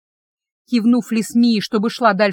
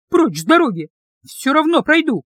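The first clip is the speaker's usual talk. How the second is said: louder, rising angry demand; angry shout; 6 semitones higher, 4 dB louder than usual. A narrator's reading. Heroine pushing past the guard, angry shout. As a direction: snap at him to move.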